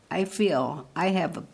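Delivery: ordinary talk, nothing marked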